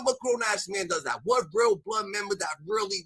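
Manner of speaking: ordinary talk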